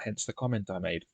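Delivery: ordinary delivery, nothing marked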